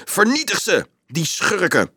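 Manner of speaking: high-pitched battle cry; rallying cry. A narrator's reading. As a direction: call out above the tumult. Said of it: "Peters stem klonk boven alles uit". (hoge, felle strijdkreet)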